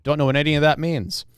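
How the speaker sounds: ordinary talk, nothing marked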